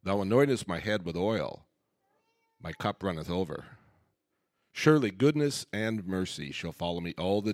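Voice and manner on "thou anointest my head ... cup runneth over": ordinary talk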